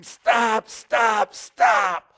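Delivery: rising frantic cry of stop, the last one very high; a boy screaming stop, each one climbing. Retold or imitated. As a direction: scream stop at a voice in your head. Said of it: "I'd start screaming".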